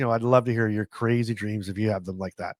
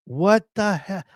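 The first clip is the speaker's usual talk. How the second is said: "What the hell?" in a raised, baffled voice; exasperated, pitched up with disbelief; mock exasperation; 7 semitones higher, slower than usual. Retold frustration over texting.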